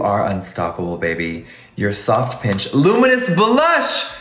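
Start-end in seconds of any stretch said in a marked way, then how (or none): 1.8-4.1 triumphant shout. Real, not acted. Vlogger crowning the blush approved, triumphant shout. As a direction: belt the approval like a grand finale.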